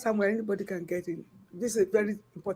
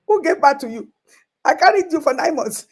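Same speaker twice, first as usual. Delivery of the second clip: hurt outburst; 6 semitones higher, 9 dB louder than usual. Real, not acted. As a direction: let the hurt burst out, loud and high. Hurt mother pleading with children.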